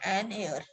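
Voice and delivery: एन एयर with no h, as "an air"; silent h. Shown as a demonstration. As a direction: say एयर starting on the vowel. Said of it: "इसको बोलते हैं".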